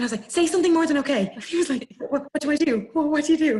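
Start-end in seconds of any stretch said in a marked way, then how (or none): 0.3-1.3 loud, high, fast outburst; high-pitched exasperated yell; panicked demand. Retold or imitated. Retold panicked snap at partner. 2.0-3.6 loud, high-pitched stammer; high, frantic retold questions; flustered panic. Imitating flustered partner.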